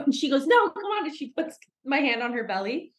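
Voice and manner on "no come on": insistent coaxing. Retold or imitated. Ruby insisting, acted quote.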